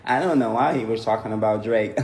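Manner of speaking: very low impression of a rapper. Comic imitating a rapper discussing [name], low voice. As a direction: drop right down to imitate him.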